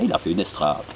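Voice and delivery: usual speaking voice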